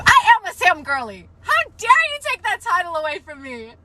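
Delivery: ordinary talk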